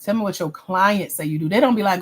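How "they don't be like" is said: ordinary talk, nothing marked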